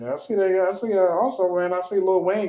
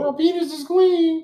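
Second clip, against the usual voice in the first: loud, raised proclamation; mock proclamation; 10 semitones higher, 6 dB louder than usual. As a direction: announce it like a royal herald.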